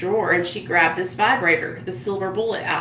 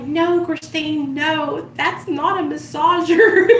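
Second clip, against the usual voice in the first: laughing protest; 7 semitones higher, slower than usual. Retold or imitated. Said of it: "And we were like".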